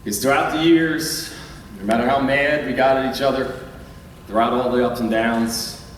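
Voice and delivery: soft, sincere, getting emotional; heartfelt thanks. Real, not acted. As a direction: thank them from the heart, voice fading. Coach thanks seniors, voice dropping.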